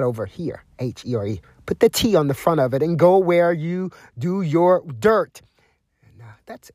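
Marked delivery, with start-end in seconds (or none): 1.7-5.4 high, loud stern warning. Real, not acted. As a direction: bark it out as a warning. Telling thugs to leave.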